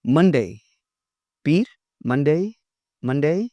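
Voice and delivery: Monday said as mun-day; teacher modelling Monday; clear model word. Shown as a demonstration. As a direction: say Monday slowly for students to copy. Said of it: "teacher models day names".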